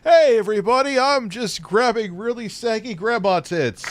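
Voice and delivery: high, loud, goofy voice mocking the streamer; mocking Pat impression. Friend mocks the streamer's stream intro in a high, loud goofy voice. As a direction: squeal it out like a dopey show-off.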